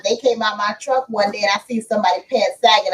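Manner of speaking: normal talking voice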